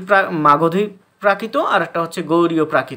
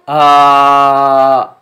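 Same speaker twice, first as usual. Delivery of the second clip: long loud open aah held from the chest; voiced vowel demo; 3 semitones lower, 11 dB louder than usual. Shown as a demonstration. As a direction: sing out one sustained aah to show the voice working. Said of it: "তখন আ শব্দটি বের হলো".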